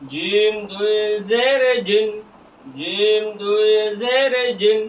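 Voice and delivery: slow, stretched syllables; measured recitation drill; drawn-out spelling. Shown as a demonstration. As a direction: hold জের long before জিন.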